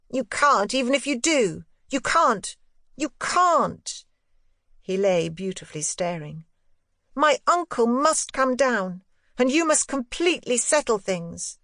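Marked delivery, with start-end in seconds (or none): 0.1-4.0 put-on high boyish voice, insistent, repeated; a boy pressing his point; insistent boy Miles. A narrator's reading. Boy insisting to his governess, high and firm. 7.2-11.6 put-on a boy laying down terms; boy Miles. A narrator's reading. Boy demanding his uncle come, high and firm.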